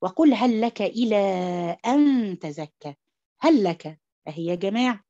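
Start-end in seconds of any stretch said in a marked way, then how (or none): 0.0-2.9 "هل لك" merged into one doubled لام, slow; idgham recitation. Shown as a demonstration. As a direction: recite slowly, letting "هل" flow into "لك". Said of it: "طيب". (تلاوة بطيئة بإدغام "هل لك")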